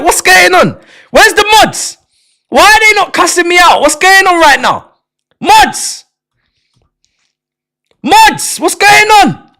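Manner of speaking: high, loud pleading to mods; calling out. Real, not acted. Streamer shouts high and loud for his chat mods. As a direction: shout up for the mods in mock outrage.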